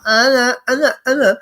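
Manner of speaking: mock child gagging at the doctor's; imitating a kid saying aah. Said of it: "and you go".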